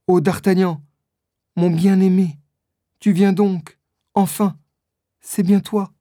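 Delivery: high, lightly acted frail voice; mildly feeble, high-pitched tone; weak voice. A narrator's reading. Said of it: "dit Constance d'une voix faible".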